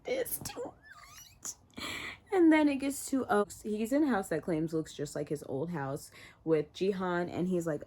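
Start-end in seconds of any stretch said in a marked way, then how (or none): 0.0-1.8 breathless whisper. Retold or imitated. Reacting to the death on screen, whispering. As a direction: barely breathe the words out, overwhelmed. Overwhelmed by the shooting scene.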